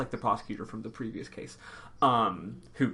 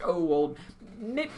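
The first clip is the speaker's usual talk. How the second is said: put-on higher, half-hearted British accent; attempted British accent; 6 semitones higher than usual. Host guessing how the police chief talks, tentative British accent. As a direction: try a British uncle, then abandon it. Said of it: "maybe has a British accent or something".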